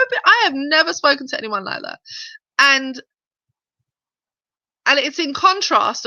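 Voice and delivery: usual speaking voice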